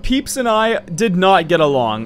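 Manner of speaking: normal talking voice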